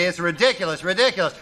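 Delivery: ordinary delivery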